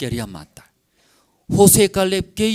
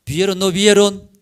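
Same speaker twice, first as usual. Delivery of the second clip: louder, higher demand for an answer; emphatic, challenging repeat of the question; insistent question; 5 semitones higher, 7 dB louder than usual. Real, not acted. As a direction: press the congregation with a raised voice.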